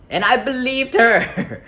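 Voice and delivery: loud, very high, drawn-out exclamation; incredulous outburst. Real, not acted. Man admitting he believed her excuse, incredulous outburst. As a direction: cry it out in disbelief at yourself. Exclaiming at his own gullibility.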